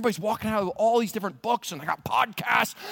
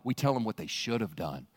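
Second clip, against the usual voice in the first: spooky guide; 13 semitones lower than usual. Acted. Guide warning the pilgrim about the dark path, ominous. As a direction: sound like a guide at a haunted trail.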